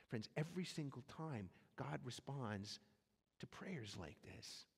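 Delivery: preacher lowering his voice to press home the point; hushed emphasis. Real, not acted. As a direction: lean in and drop it to a hush.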